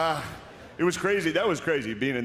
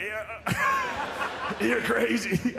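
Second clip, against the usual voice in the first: laughing; 12 semitones higher, much slower than usual. Pretend. Mock greeting bin Laden laughing.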